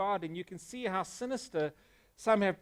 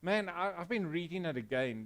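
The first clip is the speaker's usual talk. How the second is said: usual speaking voice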